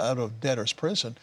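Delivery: ordinary talk, nothing marked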